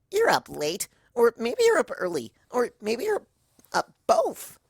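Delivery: very high, loud, bouncy pony voice; bubbly Pinkie. Acted. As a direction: burst with glee at a friend waking. Pinkie teases Luna.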